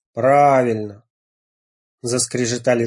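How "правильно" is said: sepulchral call. A narrator's reading. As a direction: call out from the grave. Rat guard confirming password.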